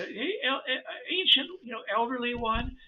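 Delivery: put-on high little-kid voice; high child's voice to an elder; small child voice. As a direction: pipe up like a tiny child. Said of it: "it's like a very small child".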